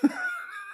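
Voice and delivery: shrill burst of laughter; high squealing laugh. Real, not acted. Laughs at own bit.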